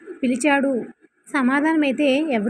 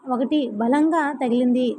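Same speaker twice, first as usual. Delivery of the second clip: usual speaking voice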